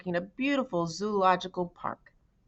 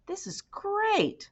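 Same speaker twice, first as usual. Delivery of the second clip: delighted; much slower than usual. A narrator's reading. Marty admiring the lake.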